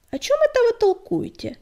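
startled, fussing question; flustered. Acted. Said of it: "всполошилась Кривуля".

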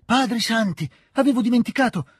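high-pitched cry of remembering; sudden exclamation. A narrator's reading. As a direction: burst out as if just remembering. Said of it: "esclamò egli a un tratto". (esclamazione improvvisa, acuta)

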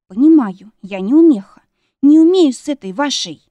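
loud, hurt self-mockery; offended outburst. A narrator's reading. Wife stung by husband's laughter, loud reproach. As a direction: fling it out loudly, voice climbing.

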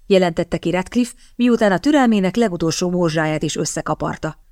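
usual speaking voice

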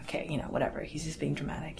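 soft, whispered eye-roll; dismissive whisper. Retold or imitated. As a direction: whisper it behind his back, dismissively. Family dismissing father's outbursts.

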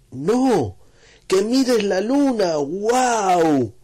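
high, loud and slow "que mires la luna"; excited insisting. Acted. José Luis urging Anastasio to look, excited insistence. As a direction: point at the sky, urgent.

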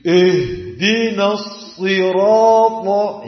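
slow chanted "Ihdinas sirata" with heavy "Ih"; wrong thick ha. Shown as a demonstration. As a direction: demonstrate the faulty recitation, thickened h in "Ihdinas". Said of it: "jangan gitu ya".

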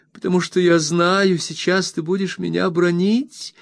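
high plaintive wife dreading a scolding; plaintive cry. A narrator's reading. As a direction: whimper it up high, afraid of a scolding. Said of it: "жалобно воскликнула Дора".

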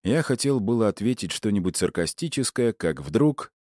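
ordinary delivery

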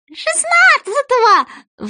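very high, slow squeak; squeaky high voice. Acted. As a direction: squeak the date in. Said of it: "вставил Соня".

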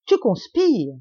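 high, loud accusation; exclaimed. A narrator's reading. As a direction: cry out at a caught plotter. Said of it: "s'écria monsieur de Sairmeuse".

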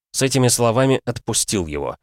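usual speaking voice

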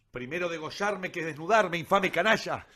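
loud, high furious cry of defiance; defiant shout. Acted. Young man shouts defiance at the judge and his men. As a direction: hurl it at them, proud and enraged. Bound youth defies the judge.

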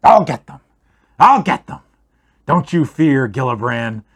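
a dying dwarf roaring at unseen foes; delirious shout. Acted. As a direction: yell it out in a fevered rage. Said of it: "and starts to shout".

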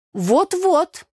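very high, loud chime-in; eager agreement. Acted. Tanya chiming in, excited. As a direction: jump in eagerly. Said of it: "подала голос Таня".

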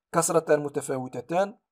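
normal talking voice

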